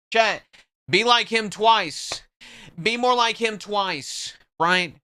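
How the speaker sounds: rally the chat like a hype man; real excited shout-out; hype call to chat. Real, not acted. Hyping chat after the gift.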